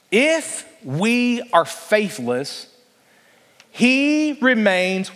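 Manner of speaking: drawn-out, weighty reading of scripture; slow down and let each word land; reading scripture slowly, leaving a gap for the congregation. Shown as a demonstration. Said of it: "what's the next word?".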